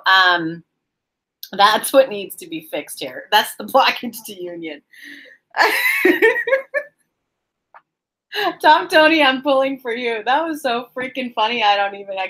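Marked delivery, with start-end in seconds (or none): 5.6-6.8 high, helpless giggling burst; real laughing fit. Real, not acted. Reader losing it over a viewer's comment, laughing hard. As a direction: crack up in rapid giggles.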